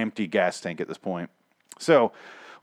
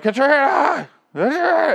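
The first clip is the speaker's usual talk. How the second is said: much higher, loud mock call; 6 dB louder, slower than usual. Paul searching the building for his wife, loud high call. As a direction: call her name across the building. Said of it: "he's walking around going".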